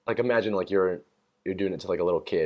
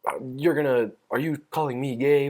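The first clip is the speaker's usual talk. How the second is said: mock offended; 5 semitones higher than usual. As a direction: pretend indignation, rising in pitch. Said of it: "acted like I was super fucking offended and mad".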